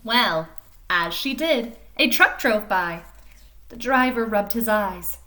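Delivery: lively storybook reading voice; animated picture-book narration; reading to children. A narrator's reading. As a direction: read it like story time for little kids. Reads picture book aloud.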